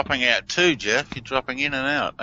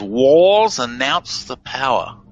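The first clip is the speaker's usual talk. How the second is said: slow, slightly louder, each word laid out; slow spelled-out reversal; 5 dB louder, slower than usual. Shown as a demonstration. Radio host slowly reads out a reversal's hidden message. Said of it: "And he says".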